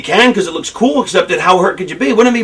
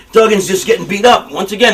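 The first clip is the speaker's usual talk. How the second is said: ordinary talk, nothing marked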